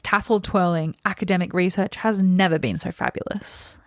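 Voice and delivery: usual speaking voice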